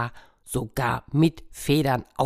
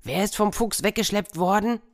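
farmer asking; 7 semitones higher than usual. Acted.

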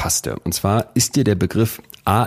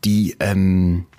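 ordinary talk, nothing marked

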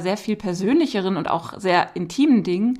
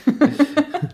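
laughing; 6 semitones higher than usual. Real, not acted. Host laughs at the teaser.

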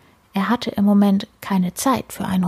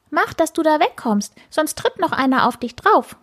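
higher-pitched urgent warning; lightly voiced warning sparrow; 8 semitones higher than usual. A narrator's reading. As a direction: warn him off, pitched up. Sparrow warns the character. (warnender Spatz, etwas höhere Stimme)

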